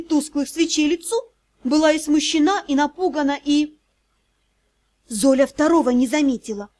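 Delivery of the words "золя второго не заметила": usual speaking voice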